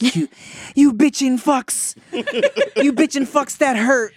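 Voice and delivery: high, slow mock British accent; hammed-up British kid swearing. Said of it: "He sounded a little British there".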